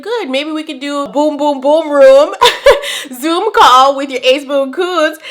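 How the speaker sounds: high, loud and giggling grandma voice; excited grandma. Retold or imitated. Grandma plans a party, excited and laughing. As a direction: gush like a thrilled grandma. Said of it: "she's like".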